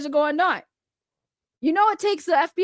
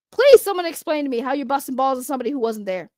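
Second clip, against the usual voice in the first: ordinary talk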